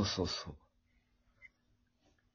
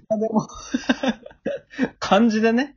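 stifled giggles; 8 semitones higher than usual. Real, not acted. Laughing while recounting.

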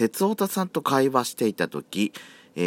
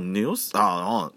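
ordinary talk, nothing marked

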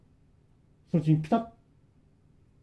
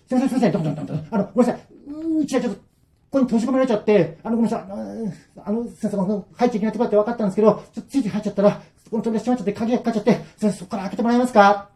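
high, fast, desperate apologies; frantic pleading; 8 semitones higher, much faster than usual. Acted. Trapped friends beg the old man to open, frantically. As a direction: beg to be let out, tripping over words.

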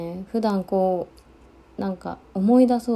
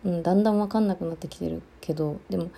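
ordinary talk, nothing marked

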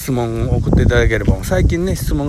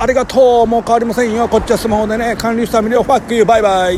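higher, louder hammed-up smug farewell; mock gloating; 10 semitones higher, 5 dB louder than usual. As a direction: tease her as a cheeky winner. Imagined woman gloating after sitting. (座った女性を想像した高めの得意げな声)

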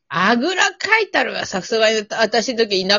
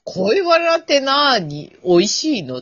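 playful feigned innocence; 8 semitones higher than usual. Pretend. Asked to talk about love, playfully feigning innocence.

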